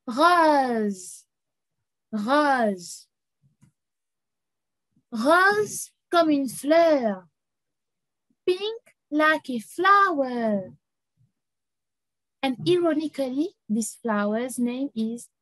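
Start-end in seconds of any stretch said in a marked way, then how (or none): 0.0-3.1 rose with a French throaty R. Shown as a demonstration. Teacher models rose in French for the class. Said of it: "Pink becomes". 5.1-10.7 slow modelling. Shown as a demonstration. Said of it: "teacher slowly models phrase".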